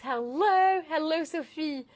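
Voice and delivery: loud, drawn-out, singing-out hello; excited greeting. Real, not acted. Host welcoming Sophie to the live, excited. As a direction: call out brightly, stretching the words.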